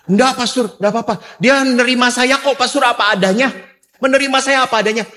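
high-pitched imitation of a pleading woman; acted pleading. Young woman defending her boyfriend to the pastor, acted pleading. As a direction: protest and plead, pitched well up.

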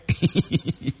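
soft rippling chuckle; short soft laugh; laughter. Real, not acted. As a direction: chuckle quietly to yourself. Amused by a comment.

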